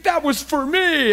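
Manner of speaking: high-pitched, acted elation; excited. Preacher acting out a churchgoer sure the message was theirs, excited. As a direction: play the thrilled listener who feels singled out. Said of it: "you get all excited".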